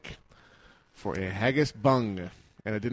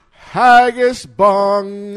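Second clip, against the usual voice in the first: "Haggis bung" drawn out slowly, much higher and much louder; dramatic booming read; 9 semitones higher, 16 dB louder, much slower than usual. Retold or imitated. Host reading a listener's words aloud, booming and slow. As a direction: declaim "Haggis bung" like a grand proclamation. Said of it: "'cause he said".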